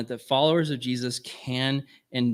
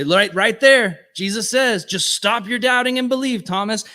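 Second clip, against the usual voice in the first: higher and louder, a mock scolding; harsh stern reading; 9 semitones higher, 6 dB louder than usual. Preacher mocking a stern reading of Jesus, loud scolding. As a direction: bark it like a harsh rebuke. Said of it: "We could just look at it and say".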